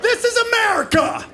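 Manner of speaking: high, strained outraged scream; hammed-up screaming outrage, held back in volume; mock screaming. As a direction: re-enact a meltdown in a high-pitched scream. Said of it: "I started screaming like".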